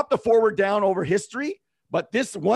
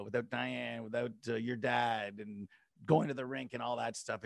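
softly moved; 7 semitones lower, 9 dB quieter than usual. Real, not acted. Moved, thinking of lost family.

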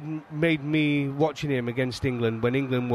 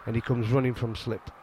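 usual speaking voice

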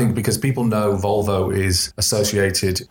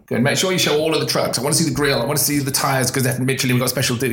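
lightly voiced pushy client, higher at the start; meddling client; 5 semitones higher, faster than usual. Client listing demands for the ad, lightly mocked. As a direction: rattle off demands as an imitated client.

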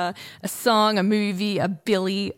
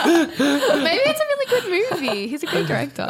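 laughing; 10 semitones higher than usual. Real, not acted. Said through laughter.

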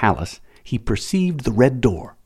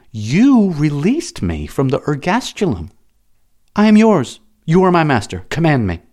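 high, loud eager servant; devoted pleading slave; 8 semitones higher, 5 dB louder than usual. Acted. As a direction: beg to serve, fervent and rising. Slave pledges devotion to Matho.

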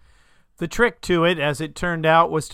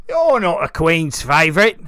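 put-on louder, sputtering toothless old farmer; 2 semitones higher, 7 dB louder than usual. A narrator's reading. Old farmer doubting the knight in his cart, sputtering. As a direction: sputter it out through missing teeth. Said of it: "the old farmer sputtered through missing teeth".

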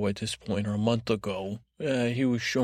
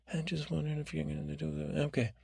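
soft, higher, quick aside; hushed interruption; 5 semitones higher, 8 dB quieter, faster than usual. Acted. Podcast guy checking in on his guest, hushed. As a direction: slip the question in quietly and quickly.

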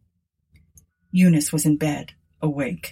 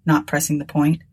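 usual speaking voice

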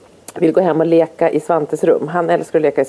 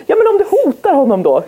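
put-on pleading child; 10 semitones higher than usual. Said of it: "Jag bara".

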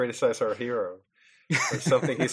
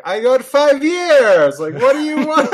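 much higher, louder, slow indignant yell breaking into a laugh; outraged Dennis Hopper; 14 semitones higher, 10 dB louder than usual. Retold or imitated. Retelling the outraged killer confronting the grieving father, high loud mock yell. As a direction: bellow it like an offended ex-con, laughing. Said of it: "And he's like".

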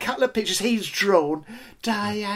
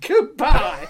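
chirpy host farewell; 7 semitones higher, 5 dB louder than usual. Retold or imitated. Showman dismissing visitors.

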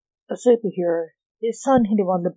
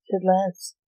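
plain reading voice

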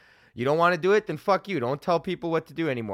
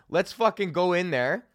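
ordinary delivery

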